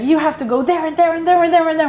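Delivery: high, fast list of orders; bossy dad. Retold or imitated. Father managing his children, quick imitated orders. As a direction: rattle off the jobs like a boss. Father dispatching kids on chores.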